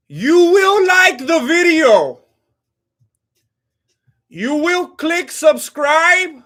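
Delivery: loud, high, very slow booming proclamation; mock hypnotic command. Streamer commanding viewers to like and subscribe, booming mock-solemn chant. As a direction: intone each phrase grandly, stretching the words.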